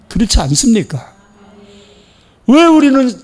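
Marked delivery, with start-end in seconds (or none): 2.5-3.1 high, louder burst; rising preacher cry. Real, not acted. Preacher challenging the congregation, loud cry. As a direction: call it out.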